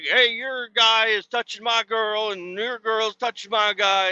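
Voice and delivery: high, whiny complaining guy voice; imitating a guy accusing another; upset guy. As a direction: protest it like a jealous teen. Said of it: "one of the guys like".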